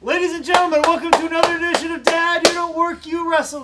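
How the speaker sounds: big, high, loud ring announcer intro; hammed-up ring announcer opening the show. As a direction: boom it out like a boxing ring announcer. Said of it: "like, a little higher pitch".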